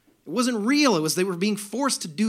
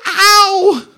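sharp gasp, then loud high cry; pained yelp; 12 semitones higher, 15 dB louder than usual. Retold or imitated. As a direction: gasp and yell out in sudden pain. Attendant hurt as he lands.

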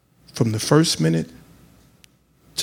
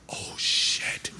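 breathy hushed whisper, much softer; whispered dread; 10 dB quieter than usual. Retold or imitated. Storyteller sensing he might win, hushed whisper. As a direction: whisper it as the realization sinks in. Realizing rival flubbed ending.

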